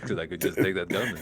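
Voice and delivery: low, quiet villain boast. Acted. Magneto threatening to take the gun, low villain voice. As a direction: echo it deep and soft. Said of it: "[name] as, as Magneto".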